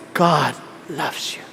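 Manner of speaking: very low, soft, slow crooned blessing; handsome preacher impression. Imitating a good-looking pastor at the pulpit, handsome preacher impression. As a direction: mimic a suave pastor, hushed and deep. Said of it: "Pag ganyan, pag preach".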